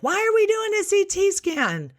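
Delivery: very high, squealed mock protest; alarmed mother. Mother challenges the tech over the scan, mock alarm. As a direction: imitate a panicking mom in a piercingly high voice.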